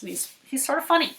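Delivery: usual speaking voice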